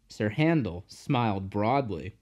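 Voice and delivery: usual speaking voice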